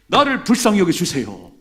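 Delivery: loud cry for mercy; shouted plea. Shown as a demonstration. Pastor showing congregation how to cry out, loud. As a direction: cry out with all you have.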